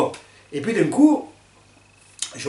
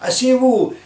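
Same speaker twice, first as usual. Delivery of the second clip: shrill demand; 11 semitones higher, 5 dB louder, slower than usual. Retold or imitated. Woman at concert demanding they sit.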